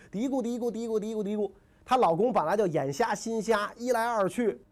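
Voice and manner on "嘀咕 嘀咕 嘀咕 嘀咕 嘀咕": higher-pitched patter of nagging; imitated nagging mutter. Stepmother working on her blind husband, imitated nagging mutter. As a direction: mimic someone yammering in an ear. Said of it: "这个前面嘀咕".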